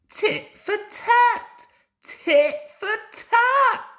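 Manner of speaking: very high, loud, slow chant; triumphant shout. A narrator's reading. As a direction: chant it as revenge. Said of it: "he shouted".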